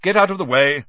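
loud, high impatient order. A narrator's reading. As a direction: cut in impatiently, raising your voice. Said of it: "said the manager impatiently".

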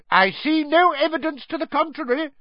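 high, angry retort matching her anger; angry shout. A narrator's reading. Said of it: "shouted Fudge, now matching her anger".

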